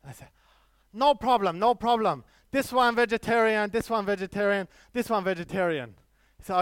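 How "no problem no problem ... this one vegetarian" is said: put-on vendor's eager Chinese accent, higher; hammed-up Chinese accent, upbeat street seller. Street vendor reassuring vegetarian customer, mock Chinese accent. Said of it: "if I do a Chinese accent".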